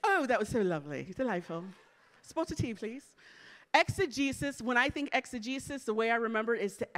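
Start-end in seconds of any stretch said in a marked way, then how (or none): 0.0-3.1 mock-posh British accent; put-on British accent. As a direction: play the delighted Brit in a hammed-up accent. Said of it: "Can you say it with an accent?".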